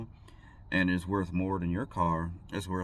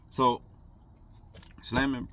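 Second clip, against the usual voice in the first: ordinary talk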